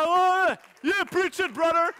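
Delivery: loud, high whoop of joy; excited cheering. Retold or imitated. Preacher retelling his cheer from the back, whooping high and loud. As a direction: cheer like a fan in the crowd.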